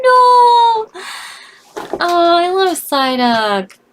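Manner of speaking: high, loud wailing cry of dismay; anguished no. Real, not acted. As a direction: cry out as your favorite dies. Favorite Psyduck just died.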